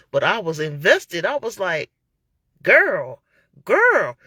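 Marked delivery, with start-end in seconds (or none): 2.6-4.2 put-on loud, stunned "girl, girl"; louder, higher exclaimed "girl"; exclaiming. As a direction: call out in disbelief. Reacting while watching the trial.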